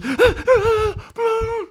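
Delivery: very high, loud imitated cries of a startled child. Father imitating his son waking from a nightmare, high startled cries. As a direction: mimic the boy jolting awake.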